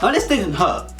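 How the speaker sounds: ordinary talk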